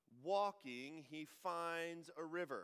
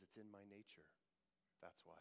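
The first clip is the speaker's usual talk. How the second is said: much softer and lower, a cold, menacing scorpion; 7 semitones lower, 18 dB quieter than usual. Acted. The scorpion coldly answering the dying frog, low and soft. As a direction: let the answer fall cold and low, without remorse.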